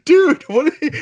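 high, loud "dude, what?"; a high, loud yelp of surprise; disbelief. Retold or imitated. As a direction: shoot up high in sudden disbelief. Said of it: "You're just like".

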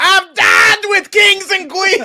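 high, slow, shouted wrestler voice; wrestler promo shout. Pretend.

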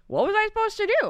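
high, loud, fast bumbling protest; hammed-up helpless excuse, pitched high; mock Finoglio. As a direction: plead innocence in a flustered comic squawk. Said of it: "they bring in Finoglio, and he's just like".

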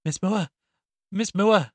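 high, loud cry for help, building; distressed calling. A narrator's reading. Distraught woman wakes her neighbour at the window, crying out. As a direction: call out urgently to wake her. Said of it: "by a distressed voice at her bedroom window crying".

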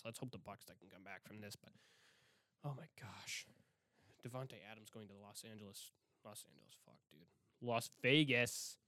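ordinary delivery

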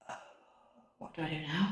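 very soft, hushed dread; stunned inner thought. Retold or imitated. New mum eyeing the baby in the basket, hushed stunned voice. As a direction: drop right down as if thinking it to yourself.

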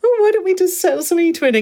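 high, bright-eyed helpful offers; eager offering voice. Retold or imitated. Retelling her offers to the primary school, high and eager. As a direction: pitch it up like a keen volunteer. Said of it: "And I did offer lots of times".

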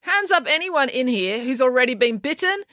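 high, slow, projected question; rallying call. Acted. Kieran addressing everyone in the restaurant, calling out. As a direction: call for a show of hands.

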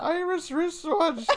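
put-on high, slow Irish accent through laughter; drawn-out, high-pitched mock Irish; attempted Irish accent. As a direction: have a giggly go at an Irish accent. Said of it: "Do an Irish accent now".

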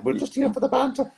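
squeaky high lads' catchphrase voice; Banter Boys voice. Retold or imitated. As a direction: pipe it up like a cocky lad out for banter. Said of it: "Ford and Greg playing".

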